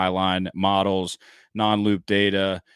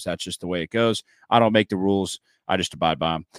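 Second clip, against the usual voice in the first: ordinary talk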